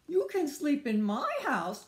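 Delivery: high, loud hail; calling out. Acted. Beaver invites the homeless friends over, calling out. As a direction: project it brightly to the group. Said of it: "called Beaver".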